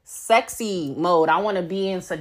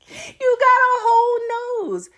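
squeaky laughing plea; 14 semitones higher, 3 dB louder, slower than usual. Real, not acted.

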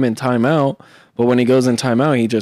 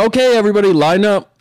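a light imitation of a coach calling the class; 8 semitones higher than usual.

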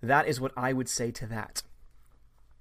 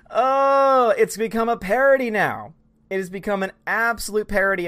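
exasperated louder outburst; 5 semitones higher, 5 dB louder than usual. Real, not acted. Exasperated fan rant.

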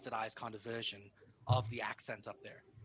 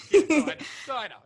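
laughing; 16 dB louder than usual. Real, not acted. Laughing high reply.

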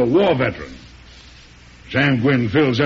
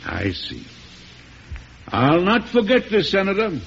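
cold threat; 7 semitones higher than usual. Acted. President threatens the senator.

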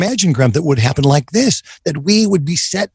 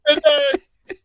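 mock polite brush-off; 11 semitones higher than usual. Retold brush-off to a come-on.

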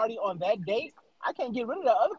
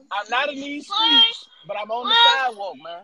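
louder hyped delivery; 10 semitones higher, 7 dB louder than usual. Shown as a demonstration. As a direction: punch it out louder, rising. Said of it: "introduce me again. I got something else".

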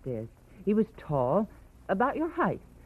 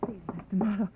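pleading; 8 semitones higher, 5 dB quieter than usual. Acted. Niece hurries after leaving Marlowe.

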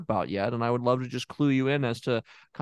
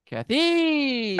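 Kathy said much higher, falling from the top; very high name; 12 semitones higher, 3 dB louder than usual. Shown as a demonstration.

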